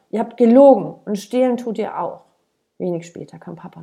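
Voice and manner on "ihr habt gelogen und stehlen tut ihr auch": loud, higher accusation; child shouting at his parents; furious yell. A narrator's reading. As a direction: hurl the accusation at them. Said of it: "rief er".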